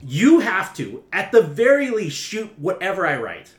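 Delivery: loud high demanding. Acted. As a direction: insist loudly, voice up high. Writer demands loudly.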